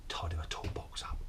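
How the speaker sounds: whispered, mocking the nagging. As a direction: sneer it under your breath. Mocking the nag under his breath.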